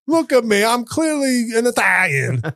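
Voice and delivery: high, whiny mock protest to Ma; Jersey kid. Comedian as a Jersey kid questioning Ma, in high mock outrage. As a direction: wail it like an aggrieved Jersey son. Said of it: "if I grew up in Jersey then".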